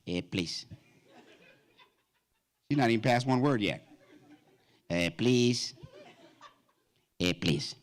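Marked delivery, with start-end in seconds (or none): 0.1-0.7 Puerto Rican witness. Retold or imitated. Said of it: "Puerto Rican woman on the news". 4.9-7.8 Puerto Rican witness. Retold or imitated. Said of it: "Puerto Rican woman on the news".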